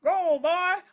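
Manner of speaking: loud, high Western holler; imitated old-movie cowboy yell; old Western gunslinger. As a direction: shout it like a cowboy ordering someone to dance. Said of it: "like the old Western where they go".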